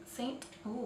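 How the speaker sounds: ordinary talk, nothing marked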